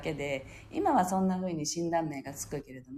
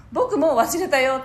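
higher, louder imitation of a child; breezy kid impression, pitched up; carefree young son; 5 semitones higher, 7 dB louder than usual. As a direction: imitate a little boy who has already moved on. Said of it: "息子が".

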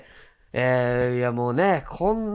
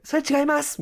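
imitating a high voice, much higher; high-voiced retort; 11 semitones higher than usual. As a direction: pipe up in a high voice to correct. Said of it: "高い声で".

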